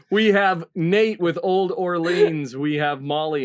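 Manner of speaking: ordinary delivery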